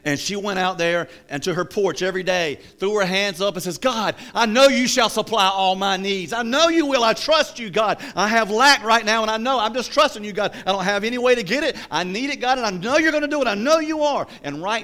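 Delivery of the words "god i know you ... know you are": high, loud, fervent cry to God; crying out a prayer as the needy woman; imitated loud praying. Woman crying out to God on her porch, fervent mock shouting. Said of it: "why she keeps screaming".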